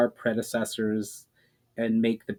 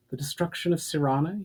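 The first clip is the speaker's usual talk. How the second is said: a mild touch of worry, pitch lifted; worried; 7 semitones higher than usual. Acted. Alira asks Valinor about Sirana, mildly worried. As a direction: ask it gently worried.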